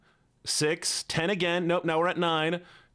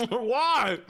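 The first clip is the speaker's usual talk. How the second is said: pained high why; 11 semitones higher than usual. Real, not acted. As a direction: squeal it like the lemon betrayed you. Reacting to the sour lemon.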